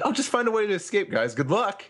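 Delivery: high mock voice. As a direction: toss it off high and careless. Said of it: "And he said".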